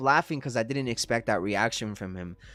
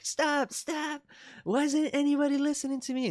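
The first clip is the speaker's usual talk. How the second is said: put-on high, frantic child's pleas, much higher; panicked kid. Mother mimics her scared son on the ride, high panicky pleading. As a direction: imitate a terrified little boy begging. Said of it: "my son was like".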